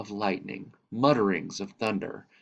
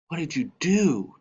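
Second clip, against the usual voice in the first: put-on a boy pressing for the answer, pitched higher; eager demand; 9 semitones higher, 4 dB louder than usual. A narrator's reading. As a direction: push the question, impatient to know. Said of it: "Thomas demanded".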